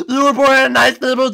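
usual speaking voice

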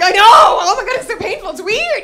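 high, loud mock distress; acted panicked yelling. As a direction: wail about the pain, hammed up. Acted panic over bleeding finger.

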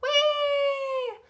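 very high, long, loud squeal; sustained high cry of delight; gleeful whee. A narrator's reading. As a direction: squeal like you're on a fast ride. Bus zooms off in story.

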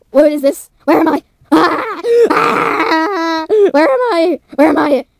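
high, loud cries of alarm; acted bewildered yelling; panicked. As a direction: cry out in panic, rising to a wail. Waking confused as a fused creature.

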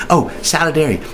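ordinary talk, nothing marked

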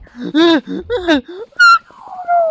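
sharp panicked gasp; loud gasp. Real, not acted. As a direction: gasp in alarm, high. About to die in game.

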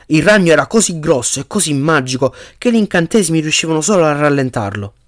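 ordinary delivery, nothing marked

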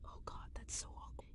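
embarrassed whisper. Real, not acted. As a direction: whisper it, mortified. Embarrassed after burping on mic.

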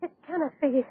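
high, shocked cries of no; horrified disbelief. Acted. Doctor seeing his patient changed, horrified. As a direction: recoil from what you have done. Doctor sees the woman transformed.

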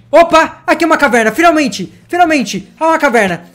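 high and loud, thrilled at last; finally found the cave, high excited cry; excited discovery. Real, not acted. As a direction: burst out with joy at finding it.

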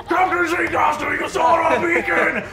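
high, strained hail to the captain; shouting hangman. Acted. Hangman hailing the captain who rescued him, shouting high. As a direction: cry out to your rescuer.

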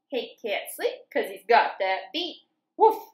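lively sing-song picture book reading; reading to children. A narrator's reading. Teacher reading farm story to children, lively sing-song. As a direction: read it bouncy, like for little kids.

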